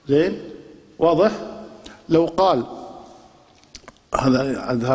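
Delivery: ordinary delivery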